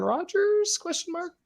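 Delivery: squeaky high rise on question mark; sudden high squeak on question mark. Real, not acted.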